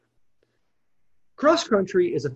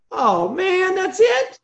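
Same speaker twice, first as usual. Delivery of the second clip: high, incredulous listener's reaction; high-pitched, louder mock complaint; mock disbelief; 11 semitones higher, 4 dB louder, much slower than usual. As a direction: blurt it out high, as if let down. Acting out a listener's let-down reaction.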